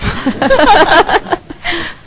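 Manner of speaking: high, loud embarrassed laugh breaking out; nervous laugh. Real, not acted. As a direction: laugh off the tears. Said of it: "I don't know why I'm getting emotional".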